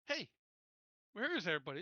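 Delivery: ordinary delivery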